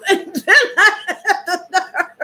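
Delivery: high words through giggles; laughing. Real, not acted. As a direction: try to talk while still laughing. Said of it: "from laughing so hard".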